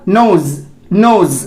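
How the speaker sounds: नोज ending in Z, "noze"; correct Z ending. Shown as a demonstration. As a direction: model नोज twice as नोज़. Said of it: "ये आपका क्या हो जाएगा?". (नोज के अंत में ज़ की ध्वनि)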